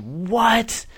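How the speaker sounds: shocked friend who can't believe it; disbelieving wife. Retold or imitated. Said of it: "she went".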